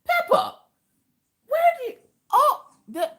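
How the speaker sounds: very high, squeaky incredulous outburst; real shocked disbelief. Real, not acted. Streamer reacting to Pippa's killer animal tales, shocked disbelief. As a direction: sputter in high-pitched disbelief.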